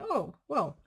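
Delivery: ordinary talk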